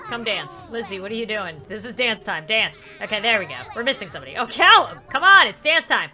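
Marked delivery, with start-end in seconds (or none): 4.4-6.1 loud, higher call. Pretend. Player calling a missing toddler to dance time, calling out. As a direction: call out insistently to a straggler.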